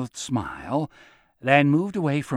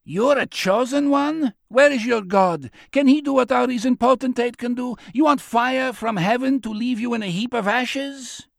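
high-pitched, raised heckling; jeering taunt; 10 semitones higher than usual. Acted. Crowd mocking the self-declared chosen one, jeering. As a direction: throw mocking questions at him.

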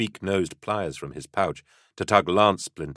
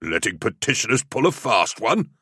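higher, indignant grumble; cursing; 6 semitones higher than usual. A narrator's reading. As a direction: curse at the humiliation. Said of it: "Andromac cursed".

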